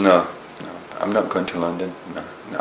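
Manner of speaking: low and quiet, dropping the voice; a muttered, dug-in no; quiet refusal. Retold or imitated. Refusing his drummer friend, low and quiet.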